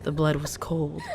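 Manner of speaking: usual speaking voice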